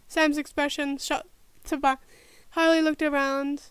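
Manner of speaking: ordinary talk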